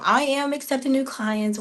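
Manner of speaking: normal talking voice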